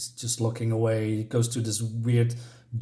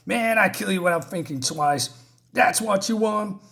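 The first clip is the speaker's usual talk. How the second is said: mock scream; 7 semitones higher than usual. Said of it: "And then he screams more".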